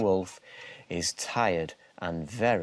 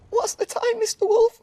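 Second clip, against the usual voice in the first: very high, loud squealy piglet voices; giggling piglets; 9 dB louder than usual. A narrator's reading. Three little pigs teasing the wolf, very high and squeaky. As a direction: tease him like cheeky little pigs. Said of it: "giggle the three little pigs".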